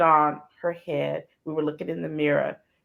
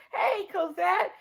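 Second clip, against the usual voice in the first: sweet greeting; 14 semitones higher, 4 dB quieter, slower than usual. Retold or imitated. Gail greeting her friend.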